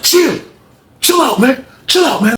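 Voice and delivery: high-pitched mock command; acted insistent order. Lamont orders during fight.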